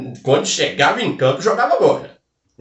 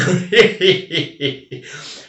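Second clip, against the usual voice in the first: lingering laugh; 5 semitones higher than usual. Real, not acted. Still chuckling at the pig in glasses, easy laugh. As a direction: chuckle trailing off.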